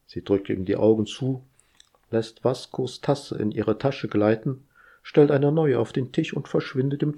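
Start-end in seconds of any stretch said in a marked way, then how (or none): none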